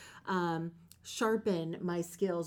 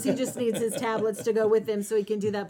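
usual speaking voice

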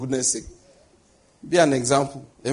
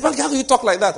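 mock shocked protest; 9 semitones higher, 4 dB louder than usual. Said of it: "They said".

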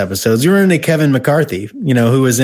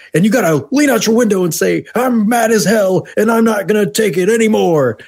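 ranting newsman; 9 semitones higher than usual. Retold or imitated. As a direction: rant it like a fed-up anchorman. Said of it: "the guy delivers the".

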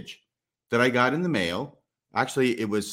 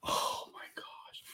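slow amazed whisper; 13 dB quieter, much slower than usual. Real, not acted. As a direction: breathe it out in wonder. Looking in the package.

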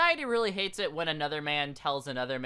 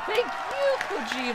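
very high, louder, gushing thank you; 5 dB louder than usual. Pretend. Host thanks Kojima back in a very high, gushing voice. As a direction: thank him like an overwhelmed fan.

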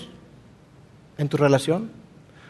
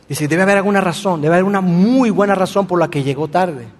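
ordinary delivery

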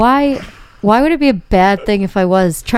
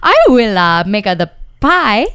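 a lightly louder mock Italian pizza man; 2 semitones higher, 3 dB louder than usual. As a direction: play the Italian pizza man, a bit hammed. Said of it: "You're Italian".

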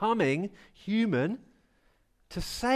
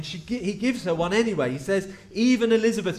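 normal talking voice

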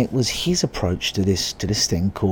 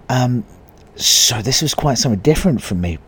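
ordinary talk, nothing marked

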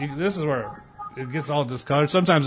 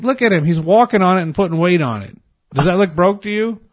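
high, raised imitation of a fed-up woman; retold incredulous retort, pitched up; mock exasperation; 7 semitones higher, 5 dB louder than usual. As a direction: argue the point loudly, imitating her. Retold woman arguing back.

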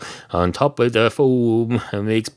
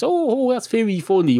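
much higher, put-on reaction; mock amusement; 13 semitones higher than usual. Cat reacting to the barman offering change, mock amused. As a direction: leap up high as if tickled by it.

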